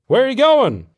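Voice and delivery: high, loud call across a distance. A narrator's reading. One soldier calls to another on the way to mess, shouting. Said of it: "one called out".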